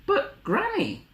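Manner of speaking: put-on very high, slow little girl; timid Little Red. Little Red doubting Granny, high timid voice. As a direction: falter in a high small voice. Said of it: "Little Red whispered".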